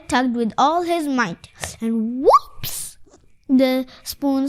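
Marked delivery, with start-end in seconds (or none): 1.8-3.0 whoops. A narrator's reading. Spoon flying in the story.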